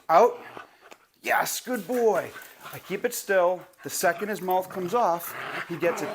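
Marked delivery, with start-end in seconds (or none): 0.1-0.4 louder, clipped command; sharp out cue to the dog; firm cue. Shown as a demonstration. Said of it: "and then I'll say". 1.2-2.3 high, slow praise; drawn-out happy praise; praise to dog. Shown as a demonstration. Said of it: "and then I'll say".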